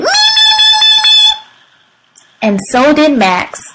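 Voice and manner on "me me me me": very high rapid squeal; eager shout. A narrator's reading. Froggy volunteers at circle time, eager shout. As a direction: squeal it like a child begging to go first. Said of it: "Froggy shouted".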